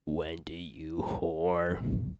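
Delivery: put-on slow, drawn-out drunk voice; mock drunk. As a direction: play a drunk stranger, dragging out the words. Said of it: "I'm drunk running into the church".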